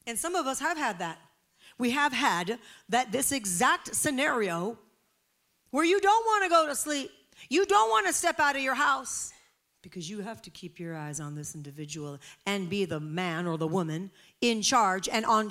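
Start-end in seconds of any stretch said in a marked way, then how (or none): 5.7-9.3 higher, louder preaching push; raised voice. Real, not acted. Preacher urging parents in the congregation, raised and loud. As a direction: press it home with force.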